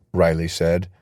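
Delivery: ordinary delivery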